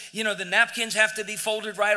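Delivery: ordinary talk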